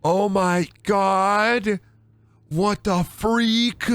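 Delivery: high, slow drawn-out shock. Real, not acted. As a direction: gasp it out in disbelief. Reacting to horror scene.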